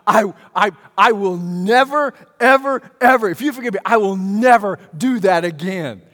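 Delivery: high, loud, over-earnest vow; bargaining plea. Acted. Preacher imitating a sinner making promises to God, bargaining plea. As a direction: act out a desperate promise, hammering each word. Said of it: "we bargain".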